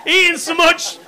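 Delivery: high, breezy cover-up voice, laughing through it; styling it out. Acted. Dad covering for the bottles to his wife, high and breezy. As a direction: pitch it up high like nothing's wrong. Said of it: "you're trying to style it out".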